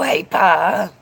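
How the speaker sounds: ordinary delivery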